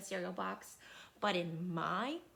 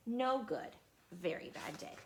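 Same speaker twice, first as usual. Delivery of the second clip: usual speaking voice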